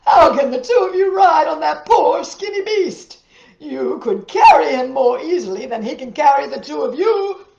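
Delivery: loud, high scoffing shout; mocking merchant. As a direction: jeer at them from the saddle.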